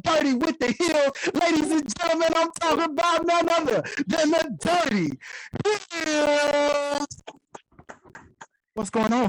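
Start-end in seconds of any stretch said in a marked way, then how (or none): 1.3-7.1 big hype intro; announcer. Acted. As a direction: announce it like a ringmaster.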